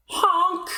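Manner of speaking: high playful honk; mock clown horn honk. Clown honks.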